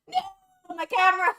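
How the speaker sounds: high, loud wail; wailing dismay. Real, not acted. Streamer loses the camera, wailing. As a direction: wail over the loss.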